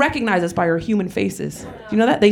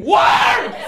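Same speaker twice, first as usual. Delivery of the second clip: high, loud mock scream; 11 semitones higher, 3 dB louder than usual. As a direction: yell it out, replaying the fall. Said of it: "look at her hand and scream".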